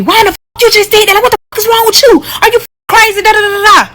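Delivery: high mock yell of the boyfriend. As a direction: shriek his freak-out in a high mocking voice. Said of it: "So he yelling at me".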